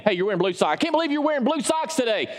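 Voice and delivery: mock-outraged sock critic; mock complainer. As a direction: play a fussy churchgoer griping about socks. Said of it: "no one's ever complained".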